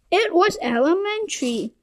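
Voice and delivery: ordinary delivery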